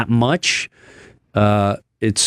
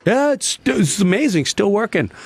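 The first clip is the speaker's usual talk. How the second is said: lightly pitched-up cheerful report; a touch of doctors' good news; retelling doctors' cheerful update, lightly pitched up; upbeat doctors; 9 semitones higher than usual. Retold or imitated. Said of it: "they're like".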